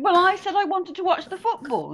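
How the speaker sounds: high, loud mock complaint; wife quarrelling about the football, imitated high and loud; nagging wife. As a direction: whine it like a wife denied the football. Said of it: "And she was going".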